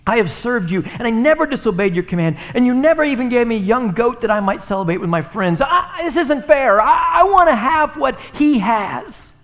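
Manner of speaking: put-on older son shouting his grievance at his father; angry older son. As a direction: rant like a wronged brother. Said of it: "He's angry. He says".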